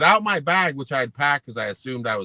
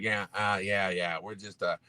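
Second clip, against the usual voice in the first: low, soft, evasive mumble; lightly voiced dismissive coach; imitating Belichick; 6 semitones lower, 5 dB quieter than usual. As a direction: mimic the coach's quiet brush-off. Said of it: "And he's like".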